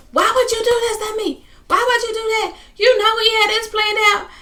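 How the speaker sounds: high-pitched, loud imitation of yelling. Upset woman confronting her girlfriend at home, yelling. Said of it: "She's just yelling".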